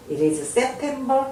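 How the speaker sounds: slowly stretched question; slow model reading. Shown as a demonstration. Teacher to learners, slowly drawn-out question. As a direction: draw out each word for learners. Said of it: "teacher models an answer".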